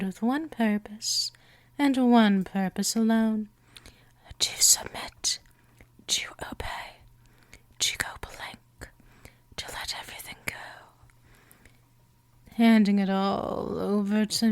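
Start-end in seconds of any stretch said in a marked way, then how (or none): 4.4-10.5 fading, breathy whispered commands, softer and softer; hypnotic whisper. Acted. Vampire mistress commanding her entranced prey, fading hypnotic whisper. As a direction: whisper the commands as they sink away.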